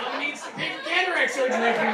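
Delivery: loud laughing joke. Real, not acted. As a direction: laugh out the punchline.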